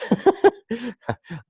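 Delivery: high-pitched chuckle at own joke; amused laugh. Real, not acted. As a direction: laugh lightly after a facetious remark. Laughs at own facetious remark.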